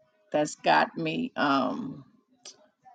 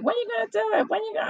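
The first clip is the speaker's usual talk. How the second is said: very high, pushy voice of friends; mock-impatient friends asking; 13 semitones higher, much faster than usual. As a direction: pester her, pitched way up. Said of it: "they're like".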